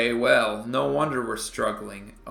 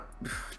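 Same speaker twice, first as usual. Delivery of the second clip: sigh; 13 dB quieter than usual. Real, not acted. Dismayed at deficit.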